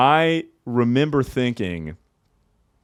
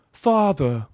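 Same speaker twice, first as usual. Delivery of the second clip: "Father" higher, British fah-thuh; British accent; 7 semitones higher than usual. Shown as a demonstration. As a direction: say "Father" like a Brit. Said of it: "in a British accent".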